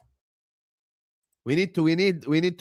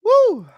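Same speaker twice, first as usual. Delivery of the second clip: loud, very high whoop; real victory whoop; celebratory woo; 10 dB louder than usual. Real, not acted. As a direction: let out a high cheering woo. Celebrating five hundred subscribers.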